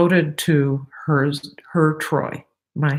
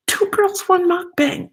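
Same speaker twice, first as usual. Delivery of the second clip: much higher, cheery shout of the name; excited announcement; 14 semitones higher than usual. Acted. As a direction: announce it like a fan. Channel name shouted high.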